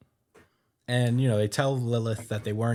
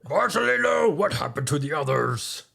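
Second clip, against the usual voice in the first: Akainu; 7 semitones higher, 6 dB louder than usual. Acted. Recapper voicing an admiral demanding news of the others, loud put-on voice. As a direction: call out in a loud, put-on high voice. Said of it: "that's my Akainu voice".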